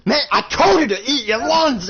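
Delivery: high, loud furious yell; angry shout. Retold or imitated. Connor yelling at Nathan to eat his lunch, shouting. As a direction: bellow the order at him. Throws him in the chair.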